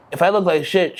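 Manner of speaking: usual speaking voice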